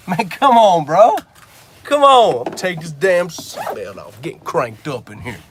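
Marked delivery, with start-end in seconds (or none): 0.1-2.4 loud, higher laughing protest; real laughing exclamation; laughing come on. Real, not acted. Laughing, cranked up while driving.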